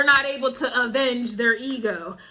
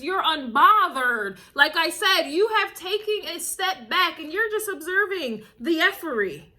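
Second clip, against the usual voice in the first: ordinary delivery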